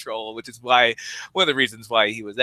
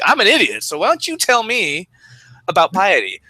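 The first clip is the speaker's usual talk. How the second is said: much higher, faux-innocent Socrates; mock-humble Socrates; 8 semitones higher, 4 dB louder than usual. Mocking Socrates feigning ignorance, high and faux-innocent. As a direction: play dumb, high and sweet. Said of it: "that's classic Socrates".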